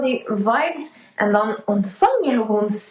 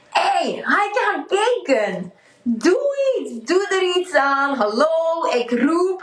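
higher, calling out as her own soul; a soul nagging to be heard, raised up; 5 semitones higher than usual. Retold or imitated. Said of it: "Omdat je ziel altijd maar roept".

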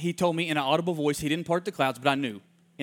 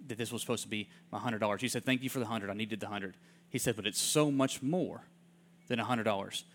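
softer, moved quoting of the worker; soft, tender retelling; 4 semitones lower, 6 dB quieter than usual. Retold or imitated. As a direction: hush down as if the man is near tears. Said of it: "He says".

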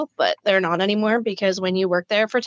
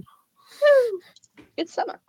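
excited cheer; much slower than usual. Real, not acted. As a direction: whoop along with the hype. Joins the hyped cheer.

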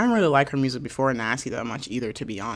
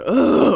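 annoyed groan; 9 semitones higher, 7 dB louder than usual. Retold or imitated. Groaning when the phone rings.

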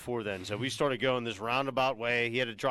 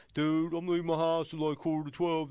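Yogi; 5 semitones higher than usual. Retold or imitated. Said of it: "So Yogi pulls the old".

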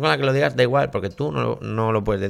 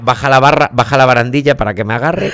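mock panic; 9 dB louder than usual. Co-host mocks being scared on a ride, loud hammed-up panic. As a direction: shout half-jokingly as if scared on a ride.

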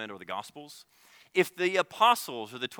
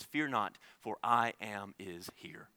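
gentle calm; 4 semitones lower, 8 dB quieter, slower than usual. Acted. Jesus calms terrified disciples.

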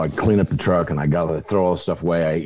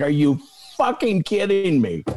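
high-pitched, incredulous outburst; can't-believe-it exasperation; exasperated disbelief; 10 semitones higher than usual. Acted. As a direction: react in exasperation, pitch jumping up. Acted reaction to bad news.

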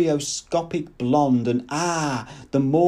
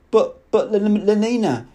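stammering protest; 8 semitones higher, 4 dB louder than usual. A narrator's reading. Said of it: "he began protesting".

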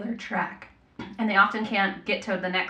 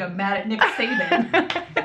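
laughing complaint; 3 semitones higher, 4 dB louder, much slower than usual. Real, not acted. Laughing punchline about football rival.

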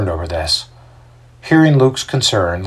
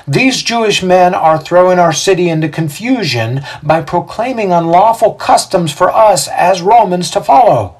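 raised, declamatory accusation; Xander, public accuser; 9 semitones higher, 4 dB louder than usual. A narrator's reading. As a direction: lay charges before the magistrates.

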